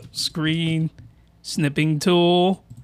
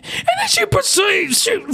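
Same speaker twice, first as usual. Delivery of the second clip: voice crack. Real, not acted. Reacting to an anime scene, voice cracking high. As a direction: voice cracks sharply upward mid-phrase. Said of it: "sorry for the voice crack".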